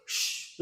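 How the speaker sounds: whispered sh, soft and hushing; a quiet hissed sh; the sh sound. Shown as a demonstration. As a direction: breathe out a clean sh. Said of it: "Repete apre".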